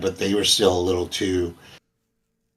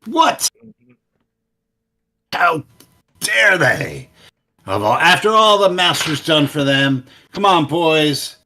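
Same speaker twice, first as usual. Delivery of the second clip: high, loud indignant squawk; outraged gnoll leader; 7 semitones higher, 5 dB louder than usual. Acted. Gnoll leader hearing the bugbears fled, high and loud outrage. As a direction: sputter in offended disbelief.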